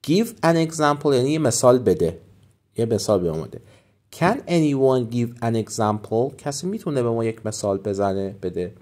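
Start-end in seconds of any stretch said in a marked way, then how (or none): 4.2-6.4 slow model question. Shown as a demonstration. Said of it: "teacher models phrase".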